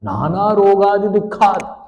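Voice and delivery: high, loud, wailing plea; crying lament. Acted. Helpless infant crying out to God, wailing and pleading.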